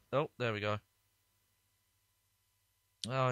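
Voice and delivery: ordinary talk, nothing marked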